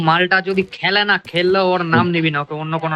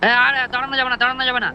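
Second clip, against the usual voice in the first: urgent warning; 6 semitones higher, faster than usual. Real, not acted.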